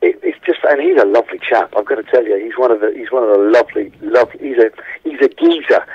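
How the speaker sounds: ordinary talk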